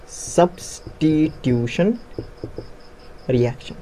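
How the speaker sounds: Substitution broken slowly into syllables; slow dictation. Shown as a demonstration. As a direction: spell out substitution by ear, syllable by syllable. Teacher dictates key term syllable by syllable.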